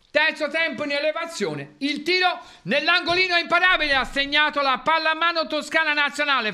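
rising, fast play-by-play of a goal; breathless italian goal commentary. Pretend. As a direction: call the shot like a goal commentator. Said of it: "faccio così".

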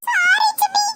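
high, whimpering sorry; tearful apology. Acted. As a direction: apologise reluctantly through tears. Child forced to apologise. (அழுகையோடு மன்னிப்பு)

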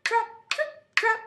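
high, short rhythmic hoof beats; hoof sounds. Shown as a demonstration. Storyteller making little goat's hoofs go, high. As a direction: chant each step high and crisp. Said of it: "make your characters go".

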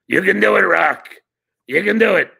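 high, loud gruff trainer pep talk; Mickey from Rocky. Retold or imitated. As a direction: bark encouragement like an old boxing coach. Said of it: "What would Mickey from Rocky say".

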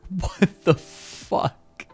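voiceless, very drawn-out shock; stunned disbelief. Real, not acted. Streamer stunned by a brutal message, breathless disbelief. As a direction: let it out slowly with almost no voice.

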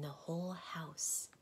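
slow breathy whisper. A narrator's reading. Soundkeeper to Milo, whispering about the empty house. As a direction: hush it as if alone at home. Hushed end of her list.

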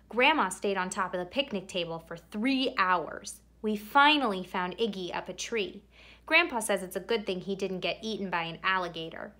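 usual speaking voice

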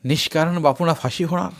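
a touch of false regret; feigned pity. Acted. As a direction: pretend you wish you could help. Mulani feigns sympathy.

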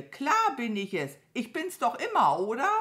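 hohe, laute Krähenstimme; aufgedrehte Krähe. Acted. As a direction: keck und laut als Krähe krähen. (hohe, kecke Krähenstimme auf Deutsch)

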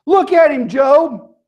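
high, loud divine order; commanding God. Retold or imitated. God shows Job the great beast, commanding.

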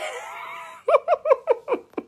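loud high laugh. Real, not acted. Mother bursting into delighted laughter over the kittens.

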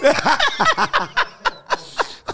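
carcajada aguda; risa. Real, not acted. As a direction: suelta una risa aguda y larga. Risa del entrevistador. (carcajada aguda de entrevistador)

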